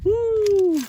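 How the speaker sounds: high, loud happy whoop; excited whoop. Real, not acted. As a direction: let out a thrilled whoop. Excited shout at a find.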